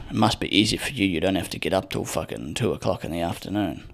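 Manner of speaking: usual speaking voice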